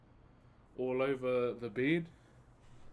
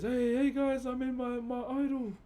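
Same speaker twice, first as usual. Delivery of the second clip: much higher and louder, a mock-excited bushman host; cheesy YouTuber greeting, pitched up high; hyped-up vlogger; 10 semitones higher, 4 dB louder than usual. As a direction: ham up a TikTok wilderness guy greeting his fans. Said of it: "this one guy goes".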